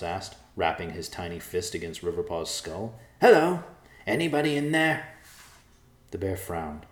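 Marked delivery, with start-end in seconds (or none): 3.2-5.1 loud, high mocking knock-knock call. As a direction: tap the skull and yell up high. Mouse knocking on bear's skull.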